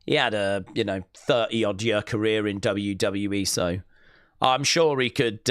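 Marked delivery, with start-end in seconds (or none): none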